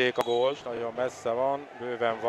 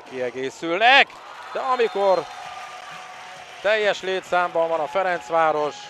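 excited commentary; 5 semitones higher, 5 dB louder than usual. Real, not acted. Rising tension on power play.

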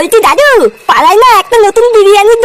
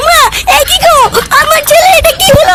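wailing cry; 7 semitones higher than usual. Acted. Mother shocked at son's state.

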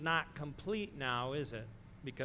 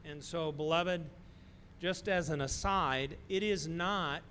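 ordinary talk, nothing marked

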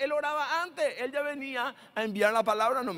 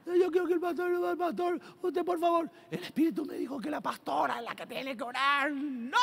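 high, whiny mock voice of a fussy believer; pleading congregant; 7 semitones higher than usual. Congregant begging for the pastor's prayer, mock high plea.

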